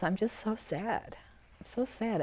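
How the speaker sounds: quiet, slow and tender; soft and sad. Shown as a demonstration. Touching into the sadness, speaking it soft and slow. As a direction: let the sadness barely come out. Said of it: "very soft and kind of tender and quiet".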